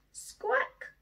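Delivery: high squawk; seagull squawk. Acted. A noisy seagull flying over, high squawk. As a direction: screech it like a gull. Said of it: "went a noisy seagull".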